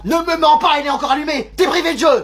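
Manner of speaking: high-pitched parental telling-off; scolding. Acted. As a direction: scold a child caught gaming. Mère qui gronde son fils. (gronder d'une voix aiguë)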